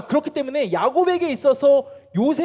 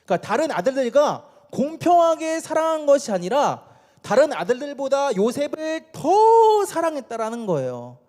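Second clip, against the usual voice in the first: normal talking voice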